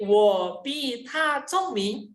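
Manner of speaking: "wo pi ta congming" drawn out syllable by syllable; slow Mandarin demo. Shown as a demonstration. Teacher modelling "wo pi ta congming" for students, very slowly. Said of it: "Kalau kita hanya menggunakan".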